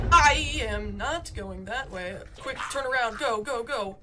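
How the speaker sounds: loud, drawn-out refusal into urgent orders; urgent gamer panic. Real, not acted. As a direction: shout it while turning to flee. Fleeing danger in the game.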